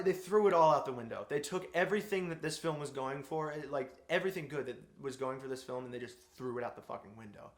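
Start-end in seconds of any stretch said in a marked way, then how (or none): none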